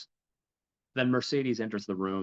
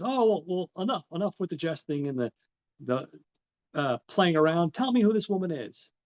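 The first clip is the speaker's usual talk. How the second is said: higher and louder, pressing him to stop teasing; impatient demand; 7 semitones higher, 3 dB louder than usual. Retold or imitated. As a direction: enough games, tell me now. Fernand impatiently demands the bride's name.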